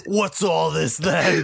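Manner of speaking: put-on slow jokey Cockney accent. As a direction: play a cheeky Cockney bobby, slowly. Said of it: "his Cockney, like".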